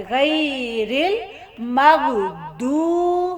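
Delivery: very slow "गैरिल मगदू", long vowels held; correct Arabic reading. Shown as a demonstration. Teacher shows how we will read it, slow recited "मगदू". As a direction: chant "मगदू" slowly with a heavy d, not z. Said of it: "हम इसको ऐसे पढ़ेंगे".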